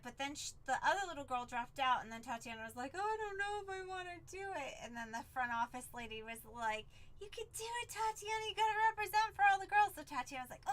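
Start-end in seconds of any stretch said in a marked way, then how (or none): 2.9-4.7 higher-pitched, quicker imitation of an unsure girl; hesitant child. 7.2-9.9 high-pitched pep talk, imitated; encouraging office lady.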